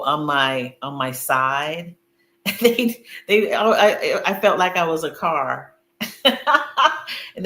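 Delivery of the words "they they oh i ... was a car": higher, louder, laughing between words; laughing at being wired up like a car. Real, not acted. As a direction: giggle through it, amused.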